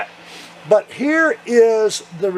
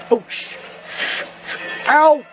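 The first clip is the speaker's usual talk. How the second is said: yelp of pain; 7 semitones higher than usual. Real, not acted. Burns himself on hot plug.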